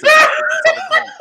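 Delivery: high, fast squeal through laughter; gleeful high-pitched mock voice; laughing squeal. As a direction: squeal the line while cracking up. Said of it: "I said".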